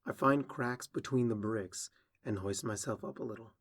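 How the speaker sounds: plain reading voice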